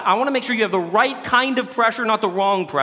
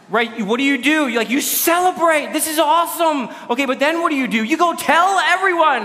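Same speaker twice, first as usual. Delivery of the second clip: excited fan; 7 semitones higher than usual. Pretend. Preacher mimics fans celebrating a World Cup win, excited and higher. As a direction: play the fan whose team just won.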